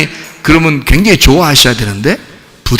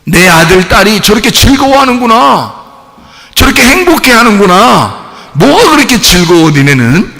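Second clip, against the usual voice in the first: higher, louder, fond marvelling; doting parent; 6 semitones higher, 3 dB louder than usual. Acted. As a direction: marvel at the kids like a proud parent. Parent voiced watching children play.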